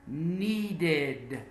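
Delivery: "Needed" in two clear syllables, nee-did; ED as separate syllable. Shown as a demonstration. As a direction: say "Needed" with a full "id" ending. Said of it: "It's a separate syllable".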